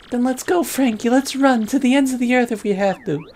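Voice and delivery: high-pitched worried sweetheart; pleading girlfriend. Acted. Daughter begs boyfriend not to go back, high pleading voice.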